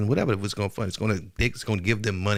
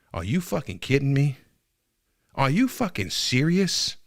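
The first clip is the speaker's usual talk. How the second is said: incredulous anger; 7 semitones higher, 3 dB louder than usual. Real, not acted. Ranting at the president's funding.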